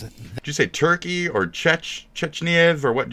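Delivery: high, loud disbelief; incredulous double-check, high and loud; baffled questioning. Real, not acted. Baffled by narrator's word.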